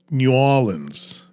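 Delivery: "New Orleans" slow, noo OR-lenz; old Yat "New Orleans", slow OR-lenz; Yat pronunciation. Shown as a demonstration. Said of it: "they would pronounce New Orleans as".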